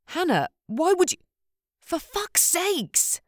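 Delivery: bursting out in disbelief; angry shout. Acted. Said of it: "There had been shouting".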